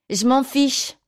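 high-pitched bratty yell; childish, high, defiant exclamation; defiant cry. A narrator's reading. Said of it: "cria Marc".

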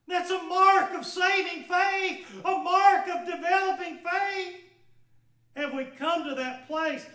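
loud, high, impassioned preaching; preaching at full force. Acted. Preacher pressing the woman's confession on the congregation, impassioned. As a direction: drive each word home to the congregation.

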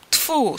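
loud, high-pitched spit of contempt; scornful spitting sound; disgusted spitting. A narrator's reading. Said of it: "splunął Bańkowski".

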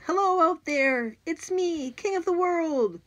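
high, loud, slow call from the roof edge; boy yelling from the roof edge. Acted. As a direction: bellow it out over the swamp.

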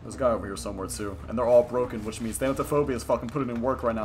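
normal talking voice

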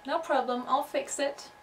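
ordinary delivery

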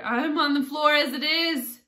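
high, loud complaint; protesting Hatter. A narrator's reading. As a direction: protest desperately at full voice.

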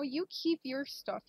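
ordinary talk, nothing marked

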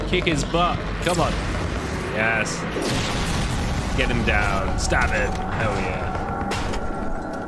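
root for him like a fan; excited cheering. Real, not acted. Cheering on the fight.

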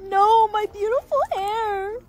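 wailing, high and tearful; high crying wail. Acted. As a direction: sob over the lost hair. Mourning her cut hair.

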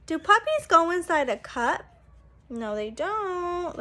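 ordinary delivery

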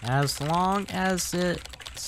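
high, very slow, drawn-out reply; mock-coy caveat. Player answering Emily's visit idea, put-on coy caveat.

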